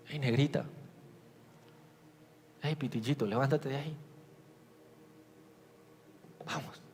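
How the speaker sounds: más suave, tierno y cariñoso; como un padre a su hija. Retold or imitated. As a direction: despertando con cariño a su hija pequeña. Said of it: "Como yo le hablaría a mi hija". (un papá le habla bajito a su hija)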